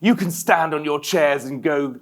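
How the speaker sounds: normal talking voice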